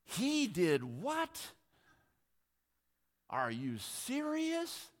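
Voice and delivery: slow, high, drawn-out disbelief; can't believe what they did; stunned disbelief. Retold or imitated. Incredulous reaction to presidents.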